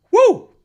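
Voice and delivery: high, loud whoop; excited woo. Real, not acted. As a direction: whoop with delight. Excited about transfers.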